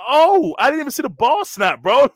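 high, loud shocked exclamation; high, loud cry of disbelief. Retold or imitated. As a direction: blurt it out, stunned the play already started. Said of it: "I said".

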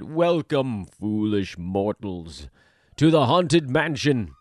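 slow, spooky Haunted Mansion host; slow theatrical villain menace. Retold or imitated. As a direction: drawl it like a ghost host. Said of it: "first three words in Disneyland's Haunted Mansion ride".

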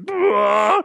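high, loud comic yell; hammed-up impression of a yelping comic; Bobcat. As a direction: blurt a strangled high cry like the comedian. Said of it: "He's like".

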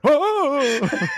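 high, loud surprised cries; comically shocked. Acted. Imitating his shocked self at work, comically surprised. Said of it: "always surprised about what's happening to you".

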